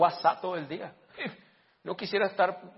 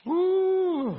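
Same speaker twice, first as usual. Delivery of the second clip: long, loud high "uuh"; high whoop; 8 dB louder than usual. Real, not acted. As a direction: let out a sustained high hoot. Exclama antes de lamentarse. (un "uuh" agudo y largo)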